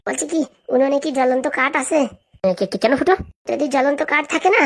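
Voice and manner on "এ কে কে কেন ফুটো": low, stuttering question; stammering. Acted. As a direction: stumble on the first word before asking. Stammered why at odd request.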